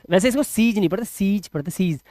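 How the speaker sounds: usual speaking voice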